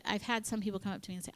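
normal talking voice

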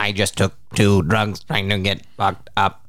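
slow droning robot voice. Pretend. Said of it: "He's got a robot voice".

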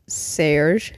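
"Serge" as SAIRZH, low; reading out pronunciation. Shown as a demonstration. Host reads the singer's name for co-host, "Serge" as SAIRZH. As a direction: draw out "Serge" with an air vowel. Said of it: "It says how to say it".

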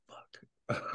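whispered swear; whispered curse. Retold or imitated. Caught by officers, whispering the curse to himself. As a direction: breathe the curse under your breath. Realising his mistake.

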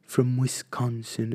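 slow hushed nature narrator; David Attenborough impression. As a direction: drawl it like a wildlife documentary. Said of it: "Vai-te fazendo assim".

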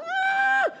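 imitated high-pitched squeal; toddler's excited scream. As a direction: squeal like an excited toddler, without extra volume. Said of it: "she'll just scream, she's like".

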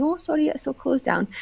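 high, soft Scottish service voice; customer service voice. Retold or imitated. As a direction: apologise sweetly in a Scottish lilt. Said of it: "doing a little customer service thing".

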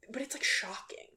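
breathy whisper of scandal; shocked whisper. Real, not acted. Friend whispering about the movie's shocking scenes, hushed whisper. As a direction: whisper it like a secret.

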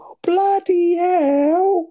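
very high, slow squeal of shock; Rodney's voice cracks as he hears he has won; squeaky high pitched. Retold or imitated. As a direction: let the voice shoot up into a squeak. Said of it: "starts going really high pitched".

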